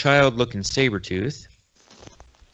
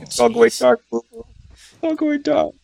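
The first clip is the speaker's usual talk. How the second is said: much higher, loud cartoon bear pleading; dying Yogi Bear; 14 semitones higher, 4 dB louder than usual. Dying bear begs his little buddy for help, hammed-up cartoon voice. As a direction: ham up a dying cartoon bear's farewell. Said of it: "Poor Yogi".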